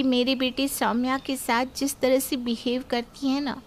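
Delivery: plain reading voice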